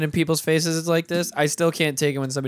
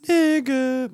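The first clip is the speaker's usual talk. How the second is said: voice cracking; 9 semitones higher than usual. Real, not acted. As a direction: voice cracks on the high note. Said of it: "My voice cracked a little bit there".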